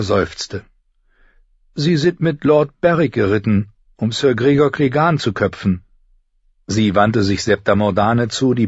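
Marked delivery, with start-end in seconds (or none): 1.7-5.8 lightly voiced girl, raised pitch; older sister explaining. Older sister tells younger where the riders went, explaining. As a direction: explain it in a higher girl's voice.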